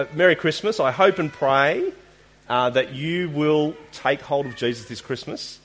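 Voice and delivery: ordinary delivery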